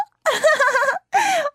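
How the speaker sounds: a bright, flustered burst of laughter; sheepish giggle; embarrassed laugh. Acted. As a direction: laugh it off, caught out.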